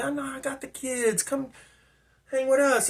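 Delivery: high-pitched, soft insistence; imitated coaxing woman. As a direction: coax him along as the woman.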